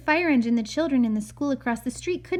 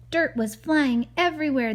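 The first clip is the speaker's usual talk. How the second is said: usual speaking voice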